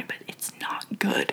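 hushed, whispered verdict; confiding whisper. Real, not acted. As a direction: whisper it like a guilty secret. Confiding criticism of a singer.

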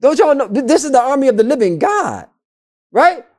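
high-pitched indignant rebuke; acted, agitated disbelief up high; incredulous outrage. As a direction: scold the troops in disbelief. Acts out David rebuking the people.

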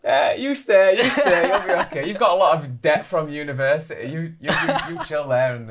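high, loud mock-parent urging; parents' voice. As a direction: imitate a parent talking you into it, high and loud. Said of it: "my parents and they were like".

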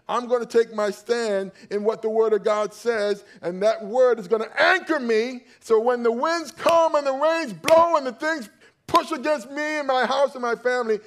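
loud and high, building through the storm; a preacher building to the storm image, loud and high; preaching climax. Acted. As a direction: drive it up to the peak.

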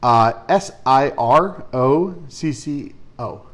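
slow letter by letter; spelling it out. Shown as a demonstration. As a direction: spell each letter separately, slowly. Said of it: "How do you spell it?".

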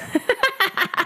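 sudden high cackling laugh; high shriek of laughter. Real, not acted. Shrieking laugh at own joke.

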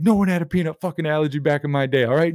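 heated impression of a fed-up comic; imitated going off. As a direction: rail against how things are nowadays. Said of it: "he was just going off".

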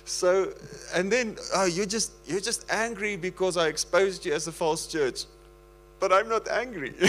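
can't hold the laugh back while telling it; giggly, breaking up; laughing through words. Real, not acted. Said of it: "I can't stop laughing".

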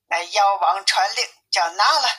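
plain reading voice

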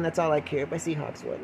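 normal talking voice

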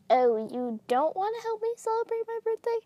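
high, small pleading voice; wheedling plea. Acted. Moth asking guests to stay for the birthday, wheedling. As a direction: ask as if let down, pitched high.

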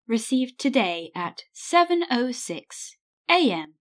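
stilted robotic voicemail announcer; word by word, with stepped machine pitch; answer phone voice. Acted. Answering machine reading out message times, robotic. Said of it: "Did you like my answer phone voice".

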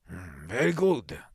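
slow, stern official. A narrator's reading. Superintendent hearing the complaint, stern. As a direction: weigh it up with slow authority. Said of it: "said Auchmilov sternly".

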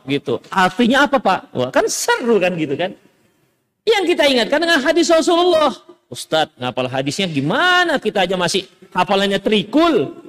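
ordinary talk, nothing marked

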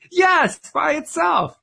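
defensive jump in pitch, stressing "by itself"; indignant insistence. A narrator's reading. Richard insisting to a laughing Don, indignant and high.

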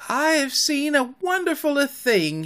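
high, marveling cry; high-voiced amazement; cried in wonder. A narrator's reading. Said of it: "he cried".

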